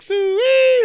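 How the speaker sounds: very high, loud calling-out; imitated high, drawn-out holler; grandma's cat call. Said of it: "her cat call".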